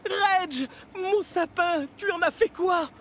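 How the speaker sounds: high upset call of a name; distressed cry. Acted. Percy calling to Reg about his lost tree. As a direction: call out in dismay.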